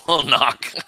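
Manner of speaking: ordinary delivery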